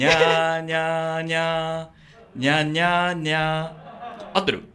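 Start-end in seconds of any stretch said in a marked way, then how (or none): 0.0-2.4 slow, stretched nya syllables; slow motion. Shown as a demonstration. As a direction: drag the nyas out slowly. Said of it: "ゆっくりです".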